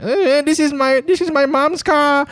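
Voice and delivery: high-pitched, drawn-out mock excuse; imitating your own awkward younger self; embarrassed excuse. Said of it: "and I'm like".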